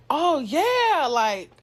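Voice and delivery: high, swooping upbeat reply; naively cheerful. Retold or imitated. Kid taking a phone message for mom, naively cheerful. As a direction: chirp it back, pleased to pass the news on.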